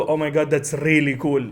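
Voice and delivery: Saudi Arabic English with a rolled R in "really"; Saudi-accented English. Retold or imitated. Friend switching accent after calling her sister, Saudi Arabic English. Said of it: "And the, the R is, like, really".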